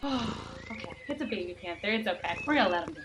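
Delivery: playacted relieved hush; hushed relief. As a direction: settle down and reassure them quietly. Pretend relief after panther scare.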